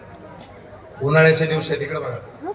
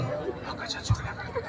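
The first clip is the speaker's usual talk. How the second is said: mock whisper; 5 semitones lower, 8 dB quieter than usual. Mocking gossipers' whisper.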